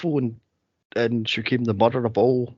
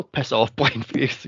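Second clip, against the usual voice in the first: usual speaking voice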